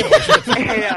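high laughing squeal; nervous giggle breaking the voice. Real, not acted. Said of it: "ya estoy nervioso". (risa nerviosa, aguda)

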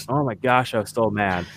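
ordinary talk

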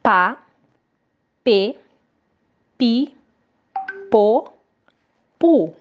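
Pá, pê, pi, pô, pu read out one by one with pauses; syllable drill. Shown as a demonstration. Teacher reads the syllables for the class to repeat. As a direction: spell out each syllable for the kids to repeat. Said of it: "A Pro vai ler e vocês podem repetir".

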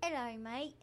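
attempted British greeting, veering Australian; British accent. Shown as a demonstration. Host announcing a British try, hammy British accent. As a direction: put on a shaky attempt at British. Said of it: "I'm gonna do British".

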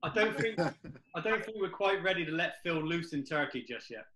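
ordinary talk, nothing marked

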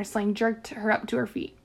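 usual speaking voice

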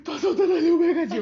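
breathless, fast, loud panic; out of breath. Retold or imitated. Girl out of breath after fleeing, telling mom. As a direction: gasp it out after pedaling hard. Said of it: "o fôlego do nervoso".

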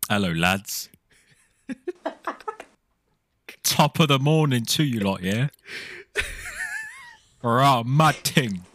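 a put-on Liverpool accent, lightly done; fake Scouse accent. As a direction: play a cocky Scouser greeting the lads. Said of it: "props to you, [name]. That was good".

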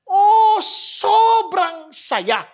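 high, loud, very slow joyous Oh, sobrang saya; overjoyed. Shown as a demonstration. Preacher showing the congregation what blessed feels like, overjoyed. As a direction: savor the joy in every syllable. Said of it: "Ang Tagalog niyan".

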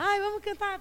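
high, mock-cheerful voice for a child; mock sing-song. A mother distracting her child on the bus, mock sing-song. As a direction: sing it out to cover the tension.

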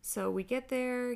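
usual speaking voice

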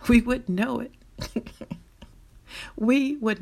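laughing through the words. Real, not acted. Laughing at end-of-world joke.

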